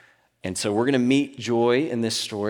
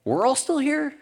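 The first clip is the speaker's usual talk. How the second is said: high urgent call; Paul shouting; 13 semitones higher, slower than usual. Retold or imitated. Paul shouting up to the jailer to stop him. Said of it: "But Paul shouted".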